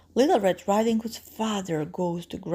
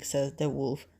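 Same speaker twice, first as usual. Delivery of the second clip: ordinary delivery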